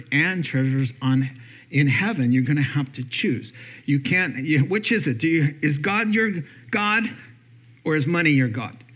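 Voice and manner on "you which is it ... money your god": usual speaking voice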